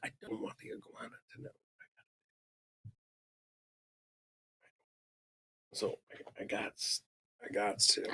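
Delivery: secretive whisper so the iguana won't hear; whispering fast and high as if to hide. Real, not acted. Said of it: "I just don't want that iguana knowing".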